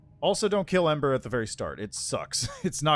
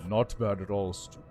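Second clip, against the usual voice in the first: lightly voiced regal undead lich; haughty undead lord, measured and cool; 4 semitones lower than usual. Lich greets the player.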